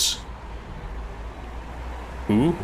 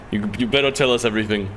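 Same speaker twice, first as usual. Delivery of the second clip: ordinary delivery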